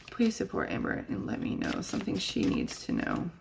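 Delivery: soft whispered plea to the guides; whispered prayer. Real, not acted. Calling on her guides and angels, whispered prayer.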